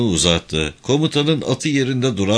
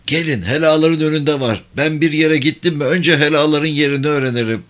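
high-pitched rustic character voice; high-voiced country soldier advising; know-it-all villager; 5 semitones higher than usual. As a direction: show off the know-how, voice pitched up. Villager character giving practical advice.

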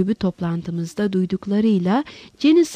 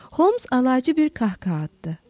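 ordinary delivery, nothing marked

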